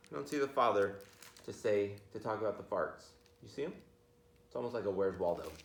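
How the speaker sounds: ordinary delivery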